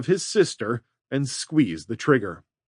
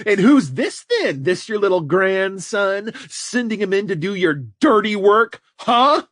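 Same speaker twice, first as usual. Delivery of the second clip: higher, laughing taunting villain; sneering villain; 7 semitones higher than usual. A narrator's reading. Red taunting the old man about his grandson, sneering. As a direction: sneer at the old man's helper.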